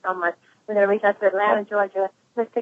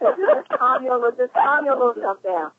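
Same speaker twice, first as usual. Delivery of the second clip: teasing calm down; 4 semitones higher than usual. Acted. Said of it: "you just have to calm me down".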